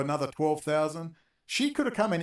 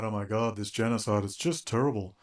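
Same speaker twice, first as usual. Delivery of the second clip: mock lament; 8 semitones lower than usual. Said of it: "every day they've all come out".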